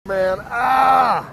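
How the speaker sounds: loud pained yell; pained shout. Real, not acted. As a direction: shout in pain. Hit by pole.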